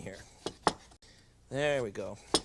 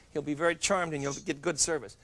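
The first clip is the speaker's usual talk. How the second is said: ordinary talk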